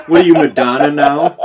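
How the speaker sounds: loud, fast mocking question through laughter; laughing tease. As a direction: tease him while cracking up. Teasing reenactor about fake accent.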